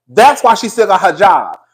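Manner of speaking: ordinary talk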